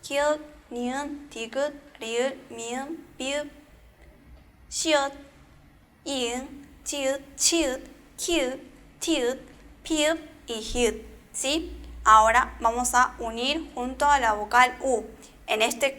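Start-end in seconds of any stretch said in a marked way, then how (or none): 0.0-11.0 slow, careful recital of each consonant name; consonant names recited. Shown as a demonstration. Teacher recites consonant names for the class, deliberately. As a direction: lay out each name clearly for learners. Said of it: "Tenemos las consonantes".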